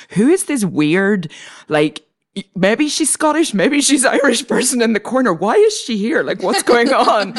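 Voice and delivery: lightly voiced confused writers; puzzled onlookers. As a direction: play the baffled crowd wondering who she is. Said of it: "they're going like".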